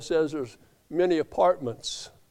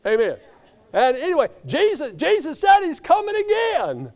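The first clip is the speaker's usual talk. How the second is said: preacher's exultant cry; 11 semitones higher, 5 dB louder than usual. Real, not acted.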